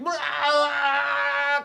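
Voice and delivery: high, exaggerated teeth-chatter brr; mock a cold shiver up high. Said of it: "but don't be like".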